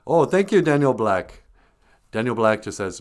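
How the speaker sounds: normal talking voice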